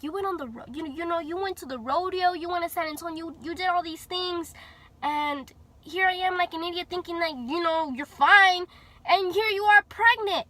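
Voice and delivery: re-enacted telling-off that climbs to a peak; scolding mom. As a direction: scold like an upset mother, rising to loud. Mom scolding in retold argument.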